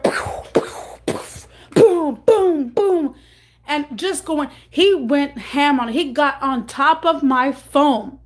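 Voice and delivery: loud high bam bam bam; hammering sounds. Retold or imitated. Showing how the boyfriend smashed the phone, loud high bams. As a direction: pound each bam like a hammer.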